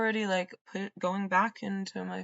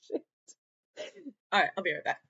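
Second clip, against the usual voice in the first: ordinary talk, nothing marked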